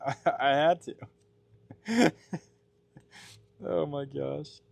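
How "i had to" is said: normal talking voice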